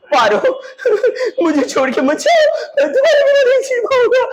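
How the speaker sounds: hammed-up wailing plea, soaring very high; tearful pleading. Shown as a demonstration. Teacher acts out lover begging Paro not to leave, tearful pleading. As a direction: beg like a melodramatic weeping lover.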